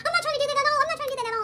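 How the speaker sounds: high, defensive protest; flustered principal. Retold or imitated. Principal denying to the mom, high and flustered. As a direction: pitch it up like a flustered principal backpedaling. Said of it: "She's like".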